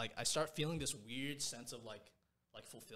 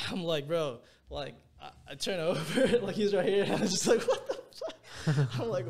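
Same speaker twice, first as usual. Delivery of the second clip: laughing through the words, much higher and louder; 7 semitones higher, 11 dB louder than usual. Real, not acted. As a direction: crack up while telling it. Laughing while retelling crying.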